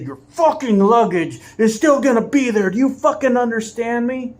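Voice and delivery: chest-puffed furious bus driver; aggressive yell. Retold or imitated. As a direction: get in her face and yell. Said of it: "jumped up in her face, chest puffed out".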